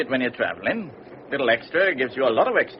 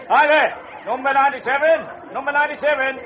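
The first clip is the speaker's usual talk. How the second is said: much higher and louder, a long call across the deck; hailing a porter; 13 semitones higher, 7 dB louder than usual. Acted. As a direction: bellow the number so the porter hears.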